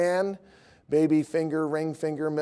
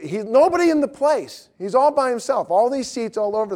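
animated; 7 semitones higher, 4 dB louder than usual. Real, not acted. Building the story's punchline.

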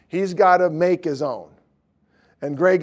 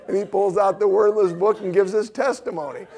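laughing through words; 4 semitones higher than usual. Real, not acted. Still laughing at the story.

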